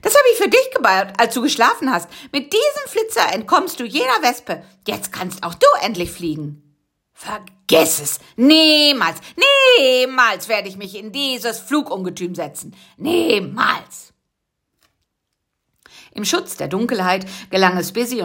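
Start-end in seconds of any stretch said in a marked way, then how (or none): 0.0-6.6 high, fast, eager reveal; proud and excited. Acted. Bisi presents the plane to his friend, high and eager. 7.1-14.1 stubborn refusal. Acted. Karl-Heinz refuses the plane.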